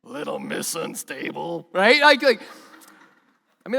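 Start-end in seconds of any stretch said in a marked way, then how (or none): 0.0-1.7 mocking jab. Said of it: "When you've wronged somebody".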